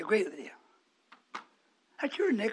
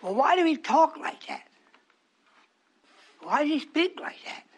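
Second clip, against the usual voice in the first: higher-pitched puzzled questioning; mock complaint; 4 semitones higher than usual. Congregation asks why the preacher talks so, mock puzzlement. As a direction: voice a baffled listener's objection.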